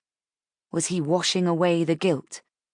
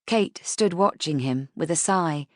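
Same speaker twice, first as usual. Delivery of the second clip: usual speaking voice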